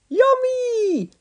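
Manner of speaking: very high gleeful squeal; greedy boy delighting; grinning. A narrator's reading. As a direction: grin through the word. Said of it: "grinned Norman".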